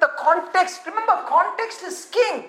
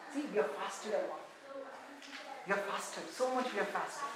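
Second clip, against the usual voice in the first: much softer and lower, nearly under the breath; a soft, low mock complaint; mock whine; 7 semitones lower, 19 dB quieter than usual. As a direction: murmur it like a self-pitying faster. Mocking the mourners' boast.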